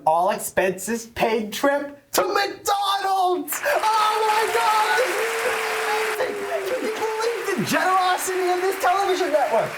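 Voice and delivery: oversell the prize like a TV host; booming prize announcer. Acted. Host hypes prize trip.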